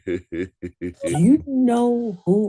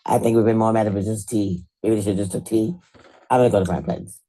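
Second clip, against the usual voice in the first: ordinary talk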